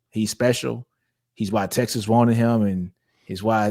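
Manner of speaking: normal talking voice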